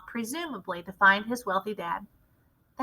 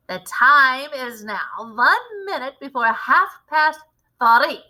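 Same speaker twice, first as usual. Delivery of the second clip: slow, louder witch announcement; announcing; 3 semitones higher, 7 dB louder, slower than usual. A narrator's reading. Witch announces the time to the audience, slow and loud. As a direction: proclaim the time to the hall. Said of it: "announced the Grand High Witch".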